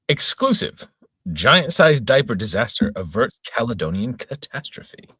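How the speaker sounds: announcing an exclusive headline with flair; mock news headline. Reader announcing the silly headline, hammed-up news voice. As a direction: read it like a tabloid splash.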